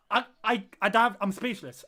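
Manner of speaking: ordinary talk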